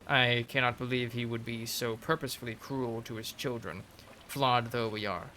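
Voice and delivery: measured reading of a sister's memoir; lore narration. Acted. Reading a game codex memoir to viewers, storyteller narration. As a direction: narrate it like an old chronicle. Reads codex entry about the pond.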